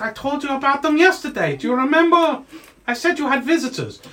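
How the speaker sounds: high, pleading gnome servant; gnomey voice. Acted. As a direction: remind your master in a little gnome voice. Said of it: "your little gnomey voice".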